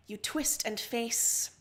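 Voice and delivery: ordinary delivery, nothing marked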